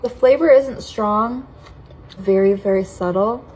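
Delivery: usual speaking voice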